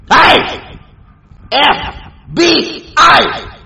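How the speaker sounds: loud, high call-out, letters drawn out; angry shout. Real, not acted. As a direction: shout each letter as a separate challenge. Angrily calling out the FBI.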